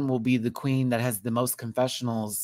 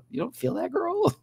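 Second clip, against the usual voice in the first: high, light imitated question; Michelle, sweetly surprised. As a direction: lift it high into a sweet, casual query. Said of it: "Michelle was so sweet, though".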